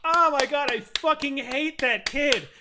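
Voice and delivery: loud, high exclaiming mom; kid's mother. Retold or imitated. Mother cheering the kid's punting, loud high outburst. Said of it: "his mother clapped and went".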